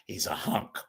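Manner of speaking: breathless laugh through the words; whispered with a chuckle. Real, not acted. Host laughing at the fans' crush, whispered through laughter. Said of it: "I'm laughing because".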